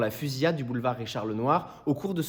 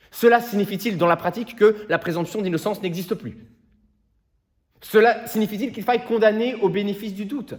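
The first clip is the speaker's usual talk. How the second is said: loud, high-pitched courtroom indignation; raised voice, forceful rhetorical questioning; indignant challenge; 6 semitones higher, 5 dB louder than usual. Acted. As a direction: fire outraged questions at the prosecution. Lawyer challenges the prosecution in court.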